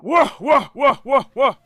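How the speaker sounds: loud, high cheering; excited cheering. Real, not acted. Keeper cheering his flying pigeons, excited and loud. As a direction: cheer the flock on.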